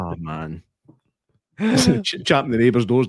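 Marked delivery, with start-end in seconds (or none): 0.0-0.6 dismayed. Acted. Coming home to burgled house.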